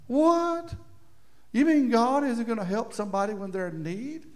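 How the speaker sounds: a high, incredulous what; mock disbelief. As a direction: play the shocked reader, pitch jumping up. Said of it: "you go".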